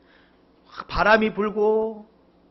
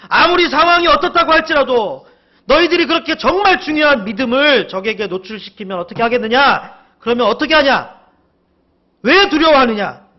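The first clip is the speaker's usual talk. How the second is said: loud, high, driving reprimand; scolding; 7 semitones higher, 9 dB louder than usual. Acted. Jesus telling off his disciples over lost faith, scolding. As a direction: berate them with full-voiced force. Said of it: "이렇게 제자들을 혼내시는 것을".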